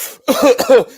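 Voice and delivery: high, loud cough; loud fake cough. Walking outside after smoking, loud high cough. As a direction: hack it out loudly. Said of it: "I be like".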